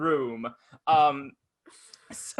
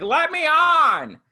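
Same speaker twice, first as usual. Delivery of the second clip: much higher, louder, slow mock yell; mock demand; 14 semitones higher, 9 dB louder, much slower than usual. Woman outside the crowded bus, mock yelling to be let on.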